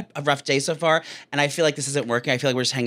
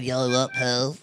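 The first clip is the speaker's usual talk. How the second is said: drug dealer voice; 3 semitones lower, 3 dB quieter, slower than usual. Retold or imitated. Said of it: "Make the voice that you make".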